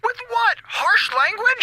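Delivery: high, frantic sarcastic yelp; squeaking in fear; panicked sarcasm. Acted. Eiffel mocks the order while the monster closes in, panicked sarcasm.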